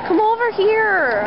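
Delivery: high, slow, drawn-out call; calling an animal over. Pretend. Visitor coaxing a cow to come over, high drawn-out calling. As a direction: sing out the call, stretching each word.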